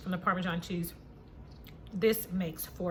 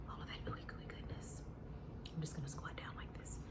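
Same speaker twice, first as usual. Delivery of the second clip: hushed whisper close to the mic; 5 semitones lower, 16 dB quieter than usual. Real, not acted. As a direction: whisper it softly. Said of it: "I feel like I have to whisper".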